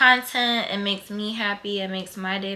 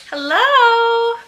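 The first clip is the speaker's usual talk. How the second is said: excited hello; 14 semitones higher, 11 dB louder than usual. Real, not acted. Vlog opening greeting, sung out.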